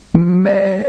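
high, plaintive sheep's bleat; pitiful bleat. A narrator's reading. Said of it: "ein jammervolles". (jämmerliches Blöken)

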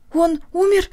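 high, anxious question; dreading the answer; fearful question. Acted. Daughter asks if father died.

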